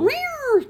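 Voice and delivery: high mock meow like a cat; a playful high-pitched meow. Cat meow callback joke.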